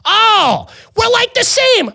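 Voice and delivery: very high mock bro excitement; obnoxious tourist. Tourist delighted to meet a Canadian, high mock voice. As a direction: gush in a high-pitched bro voice. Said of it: "and he goes".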